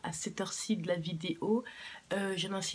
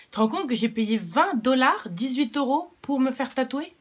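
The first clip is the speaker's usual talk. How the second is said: loud, raised-pitch disbelief at a bargain; amazed exclamation; 5 semitones higher, 9 dB louder than usual. Real, not acted. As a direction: exclaim to dad, amazed by the price. S'exclame du prix devant son père. (s'exclamer, stupéfaite)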